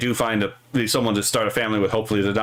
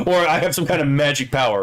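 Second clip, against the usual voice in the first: usual speaking voice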